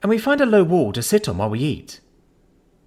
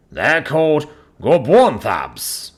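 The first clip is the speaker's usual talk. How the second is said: slow, louder voice of a huge alien vendor; giant vendor voice; 5 dB louder, slower than usual. A narrator's reading. Vendor answering the newcomer: slow, heavy giant voice. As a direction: boom it out like a towering cook.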